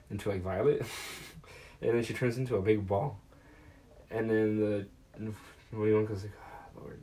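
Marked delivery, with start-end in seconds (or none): 6.4-7.0 weary sigh. Retold or imitated. Wonka sighing at the mess.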